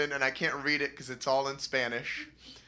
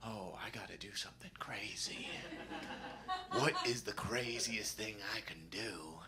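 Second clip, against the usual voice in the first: whispered thought; 5 semitones lower, 12 dB quieter than usual. Retold or imitated. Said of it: "and I was like".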